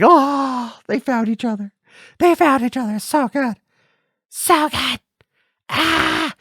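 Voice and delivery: high-pitched fangirl-style wail; overwhelmed gushing. Retold or imitated. Viewer reliving the film's ending, high overwhelmed wailing. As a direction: squeal it out, overcome with emotion. Re-enacts tearful reaction to the ending.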